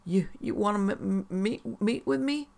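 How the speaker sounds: tearful stammer, pitch climbing to a high question; struggling through sobs. A narrator's reading. Sobbing woman asks the therapist about meeting, halting and rising. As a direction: stumble upward through the words as if fighting sobs. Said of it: "She struggled through her sobbing".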